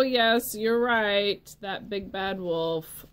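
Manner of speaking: usual speaking voice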